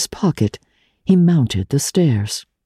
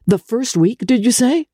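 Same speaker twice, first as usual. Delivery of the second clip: incredulous; 9 semitones higher than usual. A narrator's reading. Archer's incredulous question.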